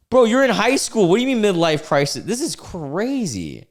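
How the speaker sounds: high, loud disbelief; incredulous outburst. Real, not acted. Host reacting to a high schooler's midlife crisis claim, incredulous. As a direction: exclaim it high, stretching out the last words.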